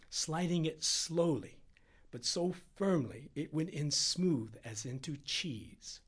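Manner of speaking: ordinary delivery, nothing marked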